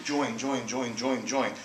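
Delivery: mock-pestering chant, lightly voiced; imitating a pushy marketer hounding him; nagging repetition. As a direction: nag him like a pushy recruiter. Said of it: "this guy coming at me".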